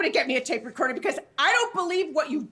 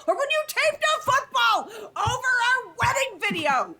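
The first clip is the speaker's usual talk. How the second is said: rising outburst; 7 semitones higher than usual. Acted. Wife listing grievances, peaking.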